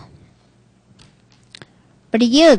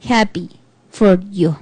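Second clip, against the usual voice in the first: "Happy for you" lower, trailing off, even more lifeless; flatter fake joy; 6 semitones lower, 4 dB quieter than usual. Shown as a demonstration. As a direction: let the happy words sink and die away. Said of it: "No implica nada".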